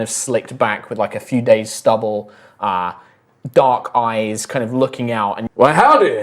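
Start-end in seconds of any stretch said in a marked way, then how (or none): 5.6-6.2 put-on deep South accent. Farmer greeting the party in a full deep South accent. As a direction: lay the Southern accent on thick. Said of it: "he doesn't have a fully deep South accent".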